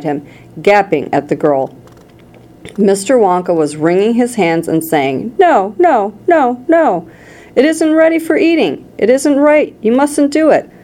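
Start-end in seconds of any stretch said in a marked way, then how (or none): 5.4-10.7 high, urgent protest; anxious pleading. A narrator's reading. Wonka begs the girl to stop, anxious high pleading.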